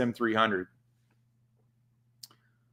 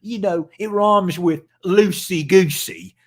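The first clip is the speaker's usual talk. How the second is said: mock British accent; 5 semitones higher, 3 dB louder than usual. Host mocks a rival YouTuber in a mock British accent. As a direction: send up the Brit with a put-on British accent. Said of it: "you old Brit".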